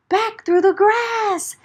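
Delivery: fast, high, breathless flight; frantic retreat; panicked rush. A narrator's reading. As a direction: hurry as if chased. Fleeing the bear.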